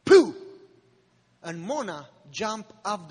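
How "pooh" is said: imitated thud. Sound of her hitting the ground.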